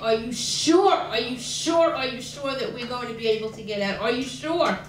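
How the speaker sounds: loud retold plea, hitting SURE; urgent anxious questioning. Terrified mother at the camp gates.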